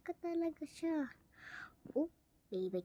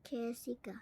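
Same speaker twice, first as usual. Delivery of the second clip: softer whispered "Jessica"; 2 semitones lower, 6 dB quieter than usual. Real, not acted. Gamer murmuring the name Jessica, whispering. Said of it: "calling name again".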